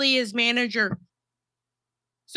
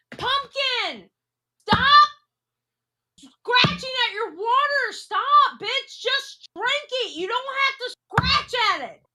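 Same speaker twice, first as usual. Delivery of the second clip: much higher pet-talk voice, scolding sweetly; talking to her cat; 10 semitones higher than usual. Real, not acted. Owner telling cat Pumpkin to stop scratching her water, high pet voice.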